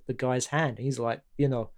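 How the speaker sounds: normal talking voice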